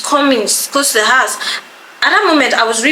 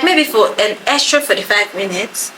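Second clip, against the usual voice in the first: ordinary talk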